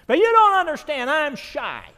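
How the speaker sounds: high, loud mock wail of objection; hammed-up protest. Shy lady pleads with the preacher, hammed-up loud protest. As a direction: burst out high and loud, imitating her.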